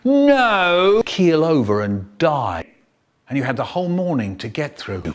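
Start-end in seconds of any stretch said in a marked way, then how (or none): 0.0-2.7 strict teacher. Retold or imitated. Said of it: "She used to stand out the front going".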